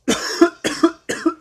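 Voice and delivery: real dry cough fit. Real, not acted. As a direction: cough hard, no words. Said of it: "My apologies for the cough".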